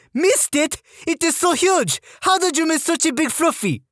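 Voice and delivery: high French-Canadian drill sergeant; French-Canadian accent. Retold or imitated. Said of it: "female French-Canadian sergeant with this accent".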